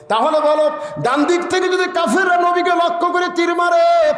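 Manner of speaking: high, impassioned sermon cry; full-force preaching. A narrator's reading. Preacher voicing one brother urging the other to guard the Prophet, high fervent delivery. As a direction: drive the question high, then start easing down.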